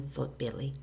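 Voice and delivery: ordinary delivery, nothing marked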